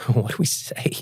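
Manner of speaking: whispered aside among friends; hushed whisper. Retold or imitated. Whispering to the guys about the drunk stranger, at a loss. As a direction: breathe it under your breath, at a loss.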